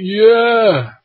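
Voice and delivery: high, loud zombie shriek; a hammed-up zombie bellow; zombie yell. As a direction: let out a loud zombie cry. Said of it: "just shot as a zombie".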